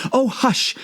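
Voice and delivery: frightened rabbit. A narrator's reading. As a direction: hush her in fear. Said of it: "whispered in a frightened tone".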